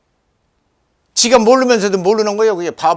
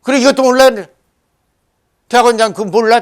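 retold scolding, higher and louder; 야단치는 말; 5 semitones higher, 4 dB louder, faster than usual. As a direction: re-enact an incredulous telling-off. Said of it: "그 야단 쳤다니까". (언성을 높여 다그치는 흉내)

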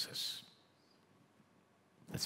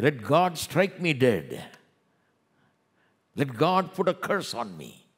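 high, loud, drawn-out mock defiance; brazen dare; 5 semitones higher, 6 dB louder than usual. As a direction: imitate scoffers daring heaven. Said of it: "open their mouths and say".

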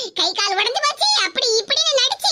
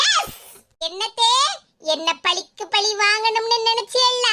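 normal talking voice

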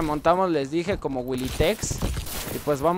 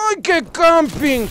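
mocking camper call; 10 semitones higher, 5 dB louder, slower than usual. Real, not acted.

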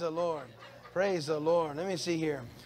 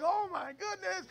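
mock dismay; 12 semitones higher than usual. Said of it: "I was like".

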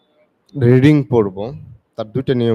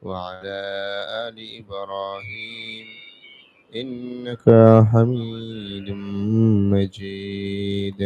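prayer recitation; 3 semitones lower, much slower than usual. Acted. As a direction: recite the blessing slowly and reverently.

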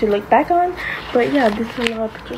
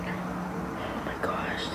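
awed whisper; 16 dB quieter, much slower than usual. Real, not acted. Reacting to baby photos.

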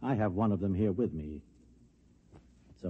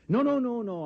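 much higher, louder, hurried denial; flustered no; 14 semitones higher, 5 dB louder than usual. Acted. Bob Adams fending off Gail on the phone, flustered and high. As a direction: wave it off in a fluster.

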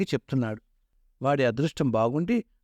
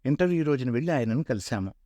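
usual speaking voice

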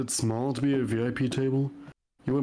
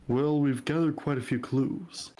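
ordinary talk, nothing marked

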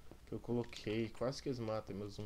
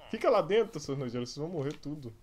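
raging gamer; 3 semitones higher, 8 dB louder than usual. Real, not acted. Raging at zombies.

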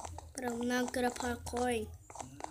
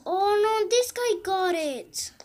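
alarmed cry; 6 semitones higher, 9 dB louder than usual. Real, not acted. Losing loot in the game.

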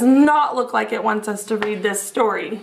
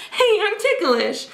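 higher, giggling cartoon slice; ticklish giggle; 6 semitones higher than usual. Pizza slice giggles under the chili flakes, lightly voiced.

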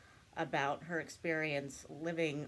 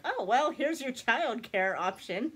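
higher teasing quip; joking; 6 semitones higher, 6 dB louder than usual. Retold or imitated. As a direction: toss it off as a playful joke. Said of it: "I said it to him jokingly".

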